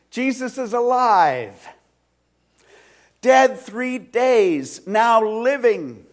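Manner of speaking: breathless Mary tells the disciples Jesus lives, exultant; excited announcement. Retold or imitated. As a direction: burst in with joyful news. Breathless Mary brings news.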